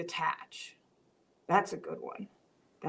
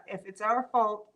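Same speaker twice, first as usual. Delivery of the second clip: usual speaking voice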